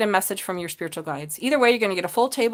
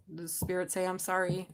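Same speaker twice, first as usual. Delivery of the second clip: softer, hushed message; hushed word from Spirit; 3 semitones lower, 9 dB quieter than usual. Acted. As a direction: deliver the message quietly. Quotes Spirit softly.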